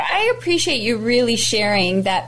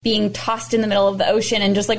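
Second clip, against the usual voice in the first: ordinary talk, nothing marked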